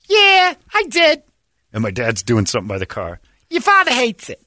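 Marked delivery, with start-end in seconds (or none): 0.1-1.2 high, slow, sing-song imitation of the girlfriend; lightly mock her chirpy reply. Retelling dad's girlfriend answering, in a high mock voice. 3.5-4.4 high mocking imitation of her; mock girlfriend voice. Girlfriend reporting dad's opinion, high mock voice.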